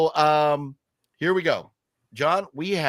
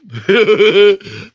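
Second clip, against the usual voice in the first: loud burst of laughter; 7 semitones higher, 13 dB louder than usual. Real, not acted. Forgetting a friend's wife's name.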